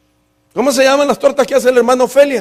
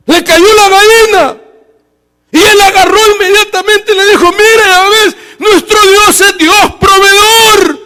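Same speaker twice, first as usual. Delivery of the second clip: higher and louder, near tears of joy; joyful shout; 10 semitones higher, 6 dB louder than usual. Acted. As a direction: shout out the miracle in triumph. Miracle hen falls, joyful shout.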